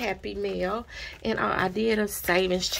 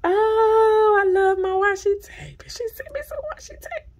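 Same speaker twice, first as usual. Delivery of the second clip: much higher and louder, then squeaky; overjoyed squeal; 12 semitones higher, 6 dB louder than usual. Real, not acted. Vlogger finding washi tape, shrieking with joy.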